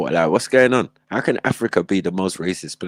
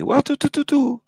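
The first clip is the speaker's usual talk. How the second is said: kickboxing sound effects. Retold or imitated. Mimicking kickboxing strikes.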